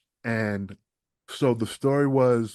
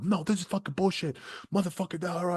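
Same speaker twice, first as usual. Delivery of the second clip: retold yelling; 9 semitones higher, 4 dB quieter than usual. Retelling his partner's fury in the ring, high mock yell. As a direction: imitate a ranting wrestler without full volume. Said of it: "he's screaming and yelling".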